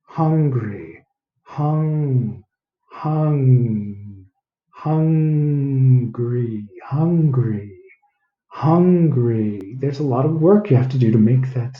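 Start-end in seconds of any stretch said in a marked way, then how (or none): 0.0-9.8 "hung" and "hungry" repeated and stretched; sounding out hungry. Shown as a demonstration. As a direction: drill hungry slowly, over and over. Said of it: "How do you say it".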